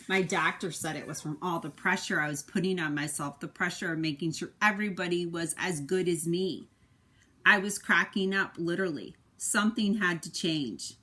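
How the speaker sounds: expressive picture-book narration; reading to children. A narrator's reading. Parent reading the egg's story to a child, expressive narration. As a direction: tell it to a little one, with feeling.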